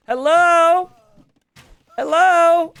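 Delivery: high, loud holler; playful high-pitched yelling for attention; calling out. Pretend. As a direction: shout hello to someone not listening. Calling out to inattentive player Spring.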